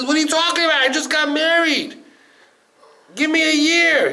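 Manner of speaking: high, urgent pleading; alarmed protest. Retold or imitated. Newly married groom bargaining with the angel of death, alarmed. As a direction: protest in a high-pitched, panicked outburst.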